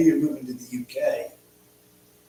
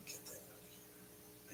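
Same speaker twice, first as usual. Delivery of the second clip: whisper; 30 dB quieter, slower than usual. Real, not acted. Muttering alone at screen.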